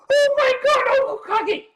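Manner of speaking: high excited cry of recognition; surprised shriek. Retold or imitated. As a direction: squeal with shocked joy. Tani recognizes him, shrieking.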